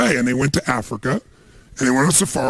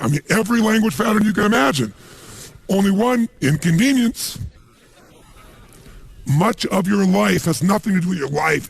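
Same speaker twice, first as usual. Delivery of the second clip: usual speaking voice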